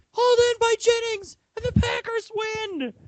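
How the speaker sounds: high, excited play-by-play call; Mike Tirico. Retold or imitated. Sportscaster calling the overtime winner, high mock excitement. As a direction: call the winning touchdown like a TV commentator. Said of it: "Mike Tirico".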